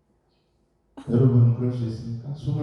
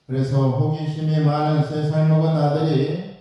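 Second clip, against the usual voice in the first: ordinary talk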